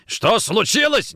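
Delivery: slow, loud, high furious echo; angry echo. Acted. Nikitin grabbing Kochkin, angry echo. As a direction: hurl the question back, drawn out. Officer angrily throws question back.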